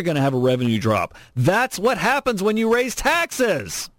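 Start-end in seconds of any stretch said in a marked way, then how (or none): 1.4-3.9 high, drawn-out exasperated cry; worked-up, incredulous rant. Real, not acted. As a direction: hammer home the obvious, pitched way up and slow. Exasperated rant about taxes.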